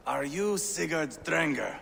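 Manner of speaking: normal talking voice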